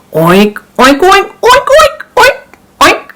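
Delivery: high squealing pig noises; pig oinks. Acted. Farmer oinking.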